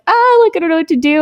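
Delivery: high-pitched mock fluster; hammed-up high worry; retold panic. Retold panic at being stained.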